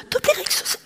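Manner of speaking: high, airy mock-angry girl's voice; breathless little outburst of anger; imitating angry young woman. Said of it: "sa hun".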